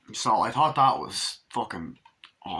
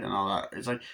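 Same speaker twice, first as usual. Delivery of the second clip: ordinary talk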